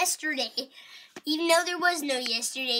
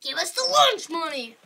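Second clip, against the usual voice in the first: ordinary talk, nothing marked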